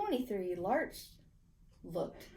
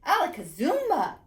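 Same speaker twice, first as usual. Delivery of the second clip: loud magic incantation; 6 semitones higher, 8 dB louder than usual. A narrator's reading. Boy casting his magic word, loud and proud. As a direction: shout the magic word with a flourish.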